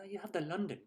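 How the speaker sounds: lightly imitated London accent. Shown as a demonstration. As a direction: put on a touch of London accent. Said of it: "so many accents in England".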